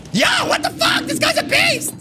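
high shouted shock at an enemy player. Real, not acted. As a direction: yelp in disbelief at him.

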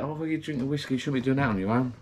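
mock moaning. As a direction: imitate someone moaning at you. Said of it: "So I'll get moaned at".

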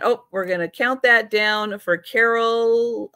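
normal talking voice

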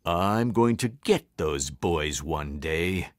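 low, slow villainous principal; mean old principal, low and deliberate; menacing vow. A narrator's reading. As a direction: vow revenge in a deep voice. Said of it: "Mister Krupp vowed".

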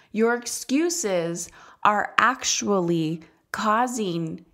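slow, deliberate words leaning on excuses; a coach slowly pressing the point home; firm coaching. Acted. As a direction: spell it out slowly so excuses land each time. Coach confronting clients' excuses.

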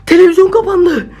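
high alarmed protest; spooked. Real, not acted. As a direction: swear it happened, still shaken. TV switched itself off, spooked.

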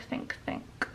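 ordinary talk

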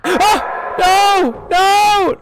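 very high, loud pleading screams; terrified screaming. Real, not acted. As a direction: scream and beg, cornered. Cornered by monster.

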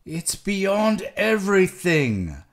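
high, loud, feverish protest; exasperated refusal. A narrator's reading.

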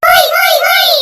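loud, rousing shouted chant; group cheer. Acted. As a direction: shout the cheer out at full volume. Everyone joins the cheer.

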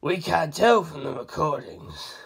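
even, unhurried answer; Abaccio, low and measured. A narrator's reading. Abaccio explaining the recordings, slow and low. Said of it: "Abaccio said".

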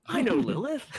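high delighted squeal; gushing with glee at a name; excited recognition. Retold or imitated. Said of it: "And I was like".

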